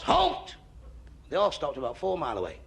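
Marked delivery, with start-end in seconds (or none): 0.0-0.5 high-pitched mock military shout; shouted command. Napoleon to his galloping troops, shouted command. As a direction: call a halt to a whole army. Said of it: "And he shouts".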